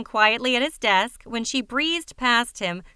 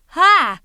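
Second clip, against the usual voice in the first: gasp; 7 semitones higher, 9 dB louder than usual. A narrator's reading. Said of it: "Lori gasped".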